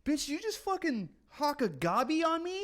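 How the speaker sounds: high-pitched, hammed-up outrage; mock outrage. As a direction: squeal it in mock disgust, far above your own voice. Acted outraged reaction to being spat on.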